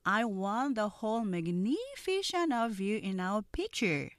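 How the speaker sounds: slow, drawn-out dialogue line; very slow read. Shown as a demonstration. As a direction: read it slowly for learners.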